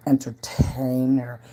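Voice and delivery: breathy stage whisper; teasing hushed whisper. Pretend. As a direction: whisper the word entertainer slowly. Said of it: "I'm a".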